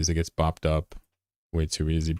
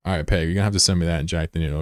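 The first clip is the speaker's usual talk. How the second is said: ordinary talk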